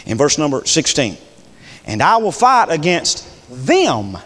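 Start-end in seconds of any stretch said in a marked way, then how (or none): 1.9-4.2 "them" drawn out on a falling tone after a slight pause; slowly single out the word "them"; stress on them. Shown as a demonstration. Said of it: "that's two different people".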